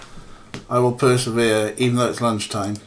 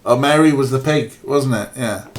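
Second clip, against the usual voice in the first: usual speaking voice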